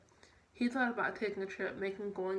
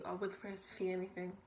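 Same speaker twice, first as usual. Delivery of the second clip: plain reading voice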